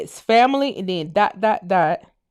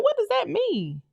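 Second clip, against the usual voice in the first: confused question; 5 semitones higher than usual. Retold or imitated. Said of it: "I'm like".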